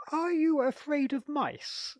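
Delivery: high, slow, nervous question; timid venture. A narrator's reading. Theodoric to the lady, nervously testing the waters, high and slow. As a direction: ease the question out, scared. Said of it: "he ventured".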